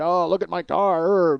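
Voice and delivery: drawn-out, put-on complaint; mock whining. Pretending to be a jagoff fussing over his car, mock whining. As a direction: stretch it out like a big baby.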